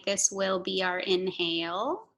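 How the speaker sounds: ordinary delivery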